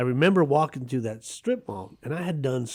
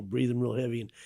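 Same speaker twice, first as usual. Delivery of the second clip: ordinary talk, nothing marked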